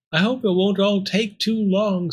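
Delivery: high, resigned little voice; despairing Pearl. Acted. Pearl awaiting her fate, despairing. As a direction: say it forlornly, pitched high.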